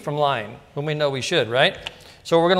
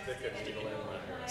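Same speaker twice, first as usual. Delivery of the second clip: barely-voiced lower mutter; under the breath; whispered aside; 8 semitones lower, 21 dB quieter than usual. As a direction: drop to a breath. Muttered aside, nearly silent.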